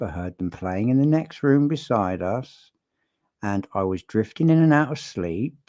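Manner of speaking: "beside us" and "sleep" rising like questions; imitated upwards inflection. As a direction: quote her with unsure lifts on "us" and "sleep". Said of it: "upwards inflection of the voice".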